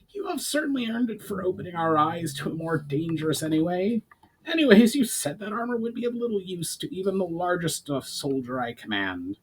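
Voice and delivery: a goblin chief in good humour; Brawl, amused goblin. A narrator's reading.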